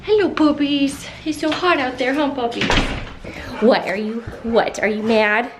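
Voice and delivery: high, loud sing-song pet talk; doggy talk. Real, not acted. Owner cooing at her puppies, high and loud. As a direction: coo at the dogs in a high voice.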